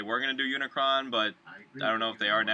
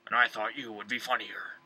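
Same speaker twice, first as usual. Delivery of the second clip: breathing heavily through a mask; muffled in a costume helmet. Real, not acted. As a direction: speak muffled, like Darth Vader. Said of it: "sound like Darth Vader over there".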